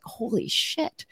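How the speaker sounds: high, slow, hushed disbelief; stunned realization. Retold or imitated. Retelling her overwhelmed realization.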